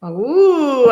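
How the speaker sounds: a loud, high, delighted ooh; excited ooh. Real, not acted. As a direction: gasp with delight at the card. Reacts to the drawn card.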